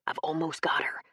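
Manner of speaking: hushed conspiratorial whisper; soft secret murmur. A narrator's reading. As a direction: whisper so no one overhears. Secret plotting in the boathouse.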